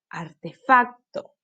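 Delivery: artefacto with stress on fac, slow. Shown as a demonstration. Teacher giving children the right stress, slowly. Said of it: "aquí está la sílaba tónica".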